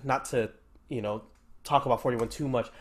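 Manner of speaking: ordinary talk, nothing marked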